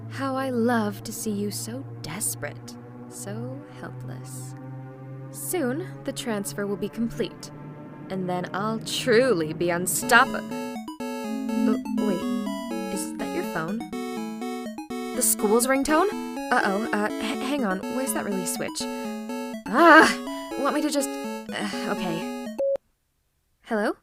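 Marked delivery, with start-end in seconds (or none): 3.1-4.5 low, soft, slow and drawn out; gloating villain murmur. Acted. Villain savours captive's helplessness. 15.1-18.8 raised, stumbling, caught off guard; villain act drops into a fluster; flustered alarm. Acted. Villain startled by phone, fumbles. 19.7-20.1 sudden loud, high "ah"; flustered exclamation. Acted. Fumbling with release switch.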